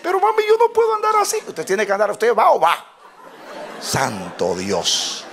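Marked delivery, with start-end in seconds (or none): 0.0-1.4 put-on fast, squealing child whine to mom; mock crying. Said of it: "Y yo, yo llorando". 1.5-2.8 imitating mom, raised and louder; insistent mother. Mother overruling the crying child.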